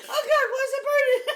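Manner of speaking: loud, high wail through laughter; mock crying. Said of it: "Keep the cry going".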